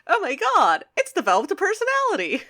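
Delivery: high, loud mock amazement; amazed inventor. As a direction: exclaim it high, as if your machine came alive. Voicing Wheeljack amazed by a drone.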